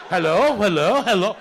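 mocking Indian call-centre greeting, repeated hello; mock Indian accent. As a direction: answer like a clueless Indian phone agent. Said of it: "Send them in there".

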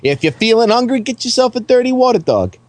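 hammed-up Brooklyn street-food pitch; mock Brooklyn accent. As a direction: play a pushy Brooklyn local giving directions. Plays Brooklyn local pitching food.

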